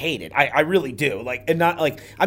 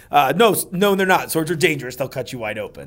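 usual speaking voice

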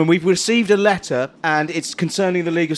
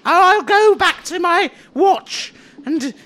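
scribe voice; 8 semitones higher than usual. Acted. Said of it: "this character".

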